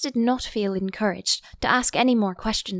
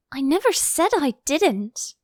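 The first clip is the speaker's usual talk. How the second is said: quick protest; 7 semitones higher, 4 dB louder than usual. A narrator's reading. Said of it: "interrupted Alice".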